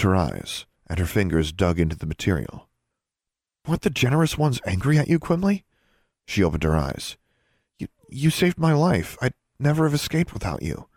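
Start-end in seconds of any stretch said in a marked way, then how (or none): 3.7-5.6 much higher, worried asking; anxious question. A narrator's reading. 7.8-10.9 stammering gratitude. A narrator's reading.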